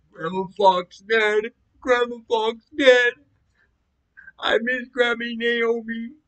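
high, drawn-out mournful cry; grieving wail. Retold or imitated. Crying out for dead Grammy Naomi, grieving wail. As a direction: wail slowly and high, stretching each word. Crying out over grandmother's death.

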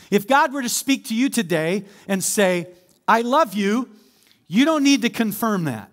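loud, high, driving preacher voice; full-force preaching. Acted. Pastor driving home God's love, loud preaching. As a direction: preach it hard to the room.

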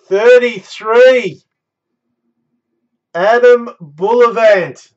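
high, loud building hype; excited hype. Real, not acted. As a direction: pump up the reveal. Hyped randomizer reveal.

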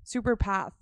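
usual speaking voice

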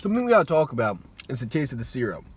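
chewing between words; talking while chewing. Real, not acted. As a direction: mouth partly full. Seen 1: bite at -3s; cheek bulge and chewing motion while speaking.

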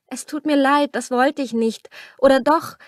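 a hint of nervous stammering. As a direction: stumble over the excuse. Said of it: "stammelte Camilla".

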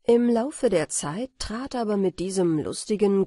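usual speaking voice

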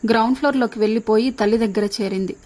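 ordinary delivery, nothing marked

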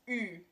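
"Ü" as a rounded front vowel; German umlaut Ü. Shown as a demonstration. Host teaching friends the first letter, German umlaut "Ü". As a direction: say "Ü" as ee through rounded lips. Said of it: "The first letter is pronounced".

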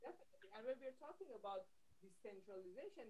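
very faint and far off; nearly inaudible, like a dropped connection; barely audible. Real, not acted. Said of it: "[name], your voice is very low".

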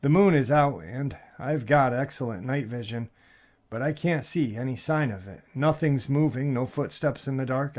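usual speaking voice

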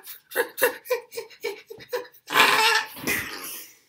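very high, loud staccato noises ending in a burst; imitated crash noises. Witness imitates truck hitting old lady, frantic loud noises. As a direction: act out the impact with frantic vocal noise.

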